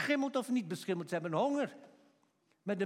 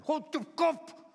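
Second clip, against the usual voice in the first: scolding rebuke; 5 semitones higher, slower than usual. Acted. Passers-by rebuking the shouting man, angry scolding. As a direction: shout him down, dragging out the insult. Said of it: "bestraften hem".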